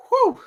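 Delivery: high, loud exhale of excitement; hyped whew. Real, not acted. Host catching his breath after hyping, excited whew. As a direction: blow out a big whew. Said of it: "I was hyped up myself".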